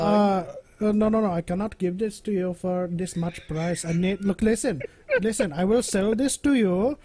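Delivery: put-on Indian-accent haggler; bartering, Indian accent. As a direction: bargain like a trader in a mock Indian accent. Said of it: "in an Indian accent is barter".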